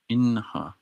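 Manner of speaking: ordinary talk, nothing marked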